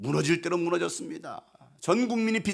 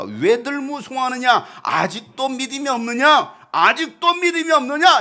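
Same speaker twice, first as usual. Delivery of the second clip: stern rebuke; 6 semitones higher, 6 dB louder than usual. Acted. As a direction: reproach them with mounting force. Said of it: "이렇게 책망하시죠". (크고 높게 책망하듯)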